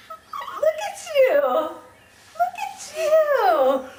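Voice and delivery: slow, high and tearful, laughing through it; a proud mother about to cry; teary with joy. Real, not acted. Mother watching her baby walk, choked up with happy tears. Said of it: "Mommy's gonna cry".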